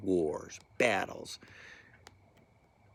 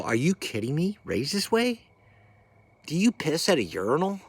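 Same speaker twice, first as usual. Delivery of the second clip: incredulous retort; 5 semitones higher than usual. Retold or imitated. Retold argument with former boss.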